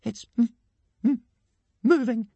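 high, loud, broken stammer; frightened stutter. A narrator's reading. Hiccup warning that the corpse moves, frightened stutter. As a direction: get stuck on the first sound, alarmed. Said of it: "stuttered Hiccup".

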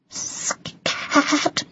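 faint, breathy squeak of a mouse; feeble voice. A narrator's reading. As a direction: stretch it out in a weak whisper. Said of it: "said the mouse in a feeble voice".

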